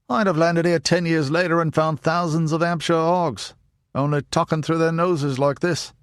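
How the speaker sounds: mock a nasal General American speaker; General American accent. Hordle mocking Americans who'd fake local speech, General American voice. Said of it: "an alarmingly accurate impression of someone who'd grown up on General American".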